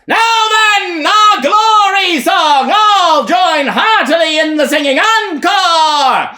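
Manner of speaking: high, loud rallying call to the crowd; shouting. Acted. Elijah shouts to start the glory song. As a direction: shout it out to lead the singing. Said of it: "He shouts".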